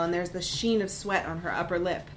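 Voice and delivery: ordinary delivery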